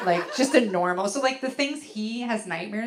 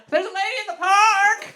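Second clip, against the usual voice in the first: high, loud nightmare scream; hammed-up terror, stretching the last word; terrified yell; 12 semitones higher, 8 dB louder than usual. Husband yelling out of a nightmare, terrified. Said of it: "he finally yelled out".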